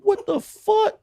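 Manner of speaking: very high disbelieving cry; shocked squeal. Retold or imitated. Watching the kid rat him out, shocked high cry. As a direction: screech it in disbelief. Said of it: "I'm like".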